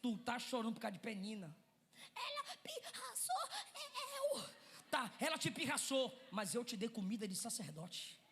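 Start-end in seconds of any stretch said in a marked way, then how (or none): 2.1-4.5 put-on high, childish complaining squeak; whiny wife. Wife whining to her husband about Penina, high and childish. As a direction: whine like a sulky child.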